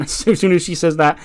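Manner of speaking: ordinary talk, nothing marked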